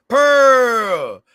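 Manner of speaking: loud, high shout of the name; excited call. Real, not acted. Greeting a viewer named Pearl, loud and high.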